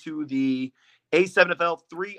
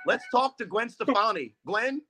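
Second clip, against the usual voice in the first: [name] impression; 7 semitones higher than usual. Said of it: "and then he's like".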